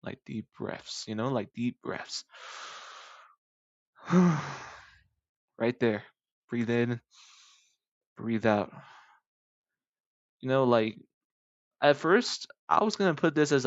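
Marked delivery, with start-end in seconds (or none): 0.0-8.7 meditation voice demo. Shown as a demonstration. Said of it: "I could be a meditation kinda dude".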